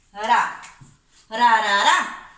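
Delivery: Ra, ra said loud with a single tapped r; syllable drill. Shown as a demonstration. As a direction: chant ra for the kids to hold. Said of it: "Vamos a retener bien esa R". (ra, ra en voz alta con r suave)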